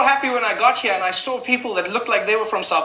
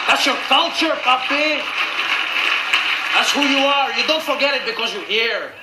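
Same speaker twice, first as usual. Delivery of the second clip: high, put-on scolding accent; imitated Spanish speaker; 4 semitones higher than usual. Stranger chiding him about his culture, imitated Spanish speaker. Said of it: "speaking to me in Spanish".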